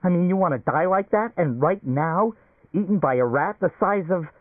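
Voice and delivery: a man terrified of a giant rat; rising shriek. A narrator's reading. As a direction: voice climbing into a scared shriek. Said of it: "his own voice rise in a shriek".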